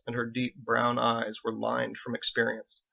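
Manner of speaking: ordinary delivery, nothing marked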